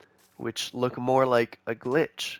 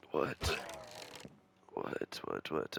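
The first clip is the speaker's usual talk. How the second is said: stunned whisper; 7 semitones lower, 13 dB quieter, much slower than usual. Real, not acted. Baffled by what he sees.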